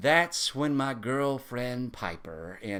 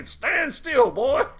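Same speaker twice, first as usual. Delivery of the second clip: higher, louder command; sharp order; 9 semitones higher, 6 dB louder, much slower than usual. Retold or imitated. Daddy Price ordering grandson to stand still, sharp command. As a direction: bark at the squirming boy.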